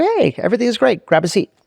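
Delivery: high-pitched, re-enacted cheeriness; breezy invitation. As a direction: act as if everything is fine. Boss feigns all is well.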